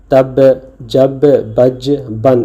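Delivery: each word spelled out slowly; slow word reading. Shown as a demonstration. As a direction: read the words slowly for the class. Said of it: "कुछ लफ्ज़ दिए गए हैं". (शब्द धीरे-धीरे पढ़ना)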